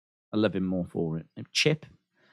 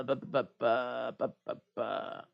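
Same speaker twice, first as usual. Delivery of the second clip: stuttering; 8 semitones lower, 12 dB quieter than usual. Retold or imitated. Mimicking Miz stuttering botch.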